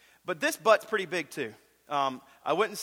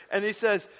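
ordinary delivery

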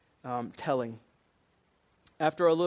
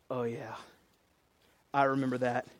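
low, soft voice of realisation; dawning recollection; 5 semitones lower, 5 dB quieter than usual. Acted. Listeners recalling the prophecy, quiet dawning recollection. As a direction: let the memory sink in quietly. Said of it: "we're all supposed to be like".

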